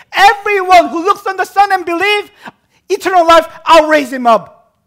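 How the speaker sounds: high, very loud scripture proclamation; full-force preaching. Real, not acted. Preacher proclaiming the promise to everyone, full-force preaching. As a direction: hammer the verse home, phrase after phrase.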